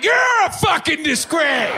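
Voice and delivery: very high, slow, shouted mimicry; shouted echo. Stoned comic yells the insult right back, high shout.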